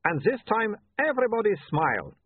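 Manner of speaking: a hint of an Uberwaldian photographer; lightly voiced Uberwaldian accent. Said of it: "don't make fun of his accent".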